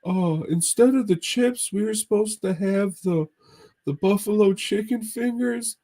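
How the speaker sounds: squeaky goofball ordering food; Mahoney impression. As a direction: ham up your buddy ordering, way up high. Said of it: "It's like".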